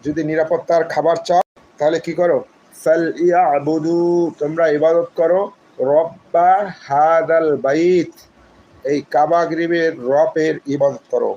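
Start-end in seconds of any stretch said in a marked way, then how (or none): 2.7-4.0 slow chanted verse words; slow recitation; Quranic recitation. Shown as a demonstration. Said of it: "recites verse". 5.9-8.1 very slow chanted verse; very slow Quranic chanting; slow recitation. Shown as a demonstration. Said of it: "recites verse".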